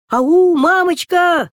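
high, loud, drawn-out call; shouting. Acted. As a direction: stretch the call far into the woods. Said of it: "Закричали они".

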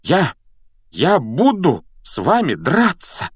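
high, slow defiant challenge; worked-up, resolute threat; very determined. A narrator's reading. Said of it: "был явно настроен очень решительно".

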